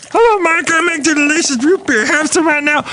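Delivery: very high, squeaky cartoon brewer voice; high cartoon brewer offering his root beer; Brewmaster character. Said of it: "thank you, Brewmaster".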